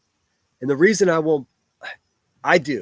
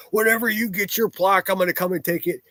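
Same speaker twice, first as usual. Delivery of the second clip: high-pitched imitation of someone's threat; mocking quote; 6 semitones higher than usual. Former channel moderator threatening to take the plaque, mockingly imitated. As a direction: mimic the threat in a higher voice.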